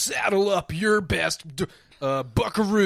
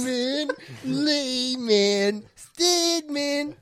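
sing-song names; 9 semitones higher, much slower than usual. Acted. Playful chant of names.

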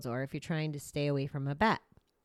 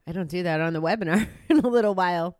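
quick words through a laugh; 2 semitones higher, 5 dB louder, faster than usual. Real, not acted. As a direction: laugh it off, fast. Laughing at own yawning.

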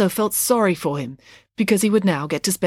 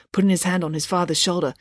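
ordinary delivery

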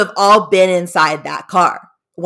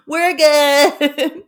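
high, singsong mock cheer; pitched-up, laughing breezy reassurance; carefree mom; 8 semitones higher than usual. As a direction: imitate a blithely unworried mom. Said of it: "She's just like".

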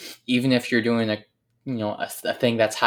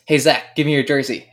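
retold buddy, higher and louder, keen; eager friend; 4 semitones higher, 6 dB louder than usual. Injured teammate asking Zach for his jersey, keen and loud. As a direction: call out for the jersey with a grin.

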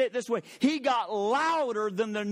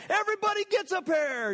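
mock Oprah giveaway; 11 semitones higher than usual. What is this, Oprah?.

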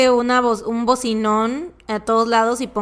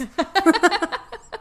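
high villainous cackle; a gleeful wicked laugh; evil laugh; 9 semitones higher than usual. Real, not acted. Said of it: "siento que es como risa malvada". (risa malvada)

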